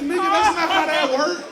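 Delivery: high, loud disbelieving yell; exasperated shout. Retold or imitated. To his daddy, a high loud exasperated yell.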